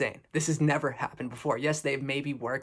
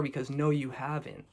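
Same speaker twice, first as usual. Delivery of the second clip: usual speaking voice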